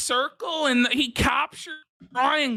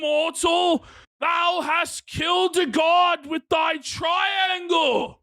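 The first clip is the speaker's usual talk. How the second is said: Odin voice; 5 semitones higher, slower than usual. Retold or imitated. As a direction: thunder it out like Odin. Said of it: "Odin said".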